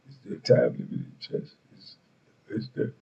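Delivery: whispered, slow and deadpan; low slow monotone. Retold or imitated. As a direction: ask it softly, no hurry. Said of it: "he's saying it so low, so slow".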